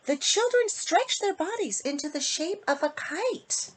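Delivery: loud, lilting storybook read with big rises; sing-song bedtime story voice for the little ones; reading to children. Acted. Author reading her book to kids in storybook voice. Reads rhyming picture book aloud.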